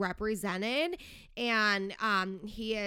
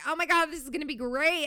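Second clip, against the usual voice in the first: louder and higher, rushed fangirl squeal; excited gush; 5 semitones higher, 5 dB louder, faster than usual. Retold or imitated. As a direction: gush like a thrilled fan, fast. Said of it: "we were like".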